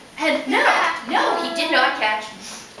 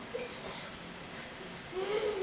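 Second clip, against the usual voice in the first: high, tiny, very soft voice; timid rabbit voice; 6 semitones higher, 16 dB quieter, much slower than usual. Acted. Nervous rabbit notices the tiger's tail, tiny timid voice. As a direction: barely dare to ask, as a rabbit.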